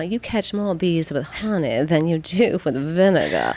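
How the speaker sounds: slow Southern grandma drawl; hammed-up Southern saying, drawn out; horrible Southern accent. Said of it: "this is gonna be a horrible Southern".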